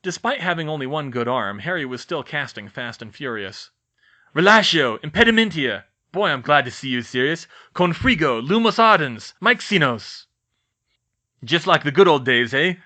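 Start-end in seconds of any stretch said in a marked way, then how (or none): 4.3-10.3 loud, breathless spell barrage; battle casting. Acted. 11.4-12.7 determined grin. Acted. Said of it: "with a determined grin".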